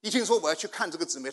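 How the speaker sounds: ordinary talk, nothing marked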